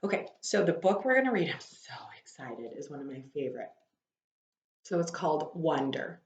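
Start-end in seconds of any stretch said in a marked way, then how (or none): 1.5-3.7 whispered aside, much softer; excited whisper. Real, not acted. Teacher whispering her excitement to the class. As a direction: let it slip out in a whisper.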